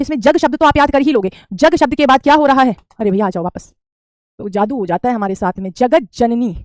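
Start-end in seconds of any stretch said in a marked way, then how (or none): none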